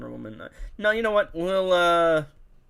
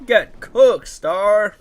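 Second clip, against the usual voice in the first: loud trash talk; 6 semitones higher, 10 dB louder, much slower than usual. Real, not acted. Trash talk on boss kill.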